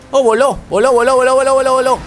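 cheering the crash as it happens; excited cheer. Real, not acted. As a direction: race commentator hyped by a crash. Car flies off during the race.

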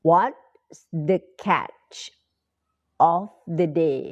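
slow syllable-by-syllable teacher model; very slow model phrase. Shown as a demonstration. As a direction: stretch each word out slowly. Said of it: "teacher repeats phrase very slowly".

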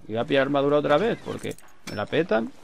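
ordinary delivery

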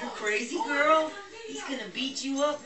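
loud, high, playful scolding; excited. Real, not acted. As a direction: tease the wild pup. Dog jumping on the other.

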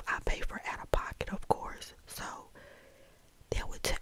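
ordinary talk, nothing marked

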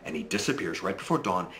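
ordinary delivery

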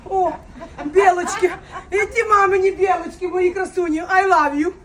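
loud, high delight at the squirrels; excited cooing. Real, not acted. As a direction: gush over the squirrels like pets.

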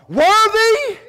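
congregation-rousing call; loud proclamation. Acted. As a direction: belt out the word high and long. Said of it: "With a loud voice, they sang this".

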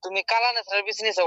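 ordinary talk

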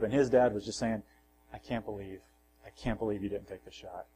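usual speaking voice

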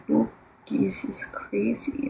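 slow creepy whisper; unhinged villain. Pretend. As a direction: whisper it like a madman. Eerie whisper to chat.

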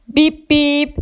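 tiz araba kornası taklidi. Acted. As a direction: korna gibi uzatarak seslen. (neşeli bip bip sesi)

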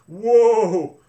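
loud high whoa; loud startled whoa; loud cry. A narrator's reading. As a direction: yelp it out to stop the horse. Ellen bouncing on a trotting horse.

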